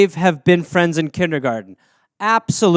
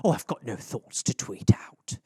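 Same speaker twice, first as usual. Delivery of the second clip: lower, soft mock-mom reply; mom, shy; 8 semitones lower, 8 dB quieter than usual. Mother declining to join Twitter, low and soft. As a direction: play a modest mother brushing off the idea. Mom answering her son.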